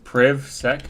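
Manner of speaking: PrivSec as a tentative "privv-sek"; short-i pronunciation. Shown as a demonstration. Streamer asking how else to say it, PrivSec as "privv-sek". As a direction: say PrivSec with the vowel of "give". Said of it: "How else would you pronounce it?".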